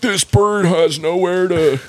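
high, slow mocking whine of an activist. Friend mocks animal rights activists, whiny. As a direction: parody the bird lovers, drawn out. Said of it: "They're like".

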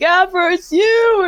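high, slow, drawn-out cry of delight; happy greeting. Retold or imitated. Star so happy every time he sees her, high delighted cry. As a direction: imitate someone overjoyed, high and stretched out.

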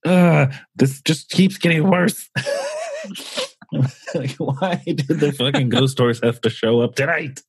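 high, laughing lament; exasperated whine. Retold or imitated. Complaining the ghost horse showed up tonight, exasperated whine. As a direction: wail helplessly, breaking into a high laugh. Exasperated that the night gets worse.

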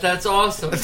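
ordinary talk

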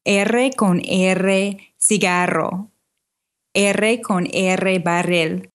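slow Spanish tongue twister, rolling every R in "R con R cigarro"; rolled R tongue twister. Shown as a demonstration. Teaching a friend's tongue twister, slow rolled R's. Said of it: "here's the phrase".

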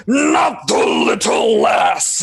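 giant's roar. Acted. Chip, grown giant, roaring at the creature, slow thunderous shout. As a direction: bellow it as he grows huge. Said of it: "and he just".

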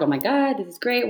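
gushing over her growing page, mock excited; excited quote. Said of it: "And I was like".

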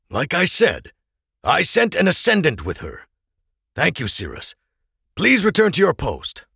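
lightly voiced Oral, firm and polite; commanding leader. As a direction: send the guard back to his post. Oral dismissing the guard.

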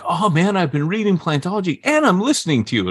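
high, quick imitated fan; gushing fan. People in serious circles greeting the host, imitated gushing fan. Voicing gushing fans he meets.

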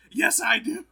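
loud high eager yell; mock enthusiastic cry; excited shout. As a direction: blurt it out eagerly. Yarael Poof's excited answer.

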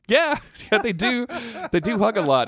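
high and laughing, yeah yeah; laughing agreement. Retold or imitated. Son answering his dad about hugging guys, high laughing. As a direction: giggle through they do hug a lot.

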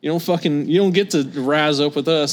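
loud, jeering schoolyard taunt; mock bully. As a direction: sneer it like a playground bully. Mocking the hostile American kids.

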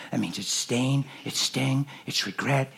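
ordinary talk, nothing marked

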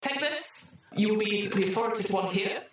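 high, bright AI announcer; the computer rewarding the troubleshooters, in a chirpy synthetic voice; cheery computer voice. Acted. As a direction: sing out the congratulations like a cheerful machine.

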